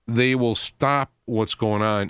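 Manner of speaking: ordinary delivery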